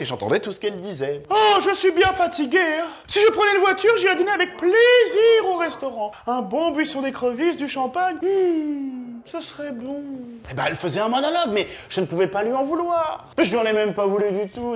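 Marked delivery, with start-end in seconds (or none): 1.3-10.0 much higher, prim lady's chatter. Retold or imitated. Comic imitating the tired lady behind him, high prim voice. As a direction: put on a high genteel lady musing. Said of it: "elle parlait à voix haute".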